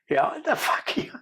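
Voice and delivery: high, slow, disbelieving; incredulous. Retold or imitated. Cop hearing the prisoner call himself an alien, disbelieving. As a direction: stretch it out in disbelief.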